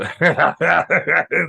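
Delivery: a loud put-on villain laugh to find the voice again; character laugh. The player resets his Russian role with a deliberate hammed-up laugh. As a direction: cackle your way back into the character. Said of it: "I just have to do the laugh".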